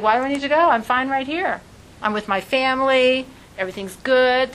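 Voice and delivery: a mildly whiny holdout; Abraham's reluctant voice. Retold or imitated. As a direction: play Abraham balking at leaving. Abraham voiced refusing to leave.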